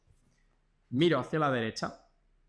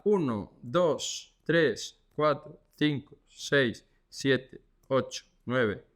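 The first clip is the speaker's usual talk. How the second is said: slow, steady counting to hold a stretch; exercise count; 2 semitones lower, much slower than usual. Shown as a demonstration. Physio counting seconds for viewers doing the exercise, slow and steady.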